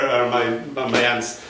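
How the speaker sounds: ordinary delivery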